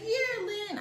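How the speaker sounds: a hammed-up sarcastic friend doubting her; teasing French friends. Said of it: "My French friends will probably be like".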